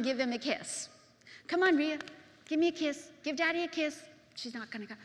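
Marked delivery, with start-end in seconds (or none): 1.5-4.0 lightly voiced daddy coaxing a child, higher; coaxing uncle. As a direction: coax a little one, raised voice. Uncle coaxing reluctant little daughter.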